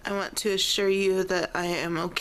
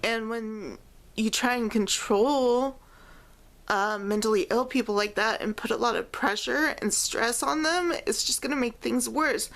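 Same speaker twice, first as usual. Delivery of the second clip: normal talking voice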